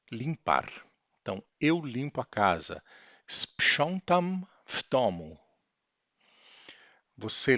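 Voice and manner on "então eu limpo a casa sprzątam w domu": ordinary talk, nothing marked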